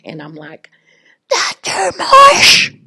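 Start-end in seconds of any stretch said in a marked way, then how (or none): 1.3-2.7 high, loud, slow cry of his name; strained call. Retold or imitated. Nurse calling back the doctor who hung up, straining. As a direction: force the name out so he hears.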